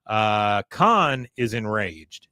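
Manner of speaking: ordinary delivery, nothing marked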